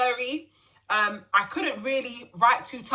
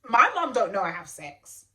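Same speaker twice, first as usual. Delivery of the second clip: normal talking voice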